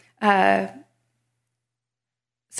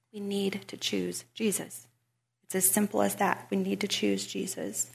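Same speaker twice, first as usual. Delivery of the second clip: much softer, sincere; hushed earnest appeal; 9 dB quieter than usual. Real, not acted. Speaker urges listeners to choose Jesus, hushed sincerity. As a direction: drop right down and say it simply.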